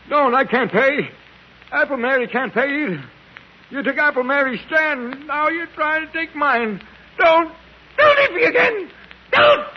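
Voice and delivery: pleas building from loud to a high scream; terrified screaming plea. Acted. Dying beggar screams at his attackers in delirium. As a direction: shriek for mercy as the blows land.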